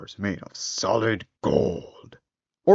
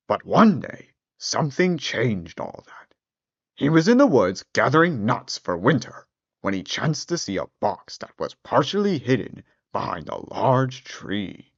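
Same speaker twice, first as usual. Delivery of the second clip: ordinary delivery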